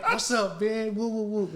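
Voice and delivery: hyped-up hello to a buddy; excited greeting. Retold or imitated. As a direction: call out happily to an old friend. Greeting Denzel in hallway.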